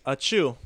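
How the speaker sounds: spoken mock achoo; fake sneeze. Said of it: "I'm gonna sneeze".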